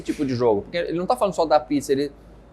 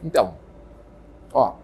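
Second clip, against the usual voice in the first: talking with mouth full of pizza; 6 semitones lower than usual. Real, not acted. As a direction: speak through a mouthful, chewing. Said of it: "minha live chama Falando de Boca Cheia".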